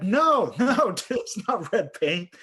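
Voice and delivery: loud, high acted-out "no"; incredulous protest. Narrator correcting injured pilot, loud incredulous protest. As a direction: protest in exasperated disbelief, voice jumping up.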